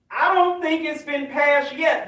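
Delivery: ordinary delivery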